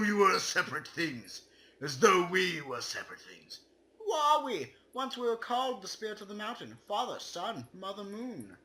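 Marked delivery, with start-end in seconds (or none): none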